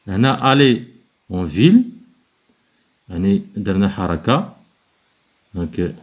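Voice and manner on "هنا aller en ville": normal talking voice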